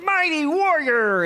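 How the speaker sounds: high, slow booming proclamation; mock-grand "Mighty warrior"; grand angel greeting. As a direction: hail him like a hero. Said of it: "the angel of the Lord is like".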